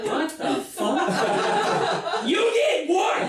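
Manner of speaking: high, loud mock disbelief from mom; hammed-up horrified mom, pitch shooting up; a mother hearing something unthinkable; shocked mom.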